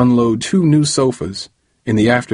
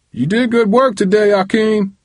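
yelling; 13 semitones higher than usual. A narrator's reading. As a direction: yell it up the stairs, much higher. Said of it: "Uncle James yelled".

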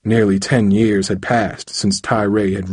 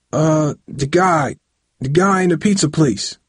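nervous stammer; 7 semitones higher than usual. A narrator's reading. Son invents an alibi for his father, stammering. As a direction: stall and stumble for an answer. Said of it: "Tyree stammered".

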